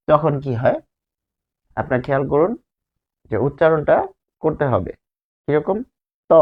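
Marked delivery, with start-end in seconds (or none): 6.3-6.3 ত said as a bare syllable with the tongue tip on the upper teeth; demonstrating ত. Shown as a demonstration. Teacher showing the class the dental sound ত, crisp and isolated. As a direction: articulate ত crisply and alone. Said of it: "ত উচ্চারণ করুন".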